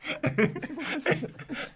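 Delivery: high wheezing laugh; laughing too hard to speak; helpless laughter. Real, not acted. Telling a rugby story to his cohost, high wheezing laugh.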